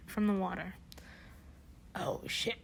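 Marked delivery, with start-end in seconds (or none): 1.9-2.6 real hushed shock. Real, not acted. Reader shocked by the story ahead.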